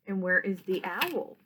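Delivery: plain reading voice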